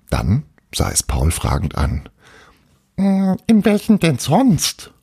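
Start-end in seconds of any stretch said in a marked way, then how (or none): 3.0-4.9 high, puzzled ghost voice; little castle ghost. Acted. Little ghost questions Paul, high-pitched puzzled voice. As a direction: ask it as a tiny puzzled ghost.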